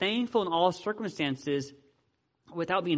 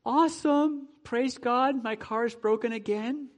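mock cheerful praise; 8 semitones higher than usual. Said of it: "It's really hard to go".

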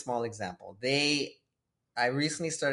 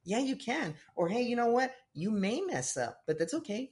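much higher, kind and reassuring; gentle encouragement; 8 semitones higher than usual. Retold or imitated. God encouraging a nervous kid, gentle reassurance. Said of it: "God doesn't talk to you like that".

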